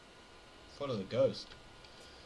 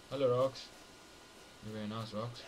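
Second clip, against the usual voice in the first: normal talking voice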